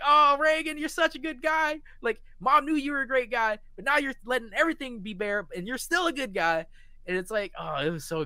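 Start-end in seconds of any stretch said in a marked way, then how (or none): 0.0-6.7 high, loud and overjoyed; gushing fan. Retold or imitated. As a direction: praise the con man. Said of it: "And I'm like".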